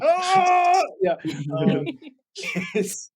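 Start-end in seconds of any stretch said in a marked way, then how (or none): 0.0-0.8 excited shout. Real, not acted. Playful outburst mid-sentence.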